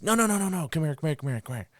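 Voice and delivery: high, urgent string of no's calling the dog back; retold panicky recall of a dog gone wrong; frantic recall. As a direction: stop him and reel him in, quick and high.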